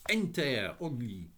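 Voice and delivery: put-on high, French-accented lady's welcome. A narrator's reading. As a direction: greet them in a lilting French accent. French lady greets the children.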